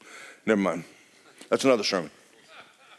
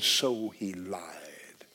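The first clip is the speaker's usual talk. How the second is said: soft, slow, breathless accusation; whispered retort; 8 semitones lower, 11 dB quieter, much slower than usual. Retold or imitated. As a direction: drop to a hush to nail the point. Pastor's retort, retold dialogue.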